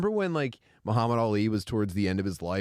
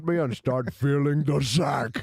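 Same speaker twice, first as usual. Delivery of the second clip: robber; 4 semitones higher than usual. Pretend. Robber voiced.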